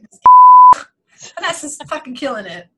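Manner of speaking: slow, big, loud cheer. Real, not acted. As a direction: belt out the praise, slowly. Cheering for the teacher.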